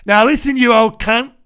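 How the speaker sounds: loud, high, hammed-up Cockney; bad Cockney gangster accent, shouted; Guy Ritchie character. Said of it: "doing his best Guy Ritchie character impression".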